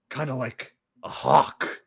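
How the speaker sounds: slow, considering murmur from the boss; slow musing boss. Retold or imitated. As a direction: mull it over slowly like an unimpressed boss.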